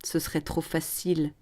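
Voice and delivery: hushed, softly dropped sneer. Acted. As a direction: scoff under the breath. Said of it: "Le promoteur ricane". (ricanement à voix basse)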